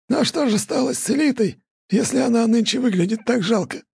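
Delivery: high, creaky village elder; old elder voice. A narrator's reading. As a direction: voice the old man gently. Elder asks a question. (голос старика)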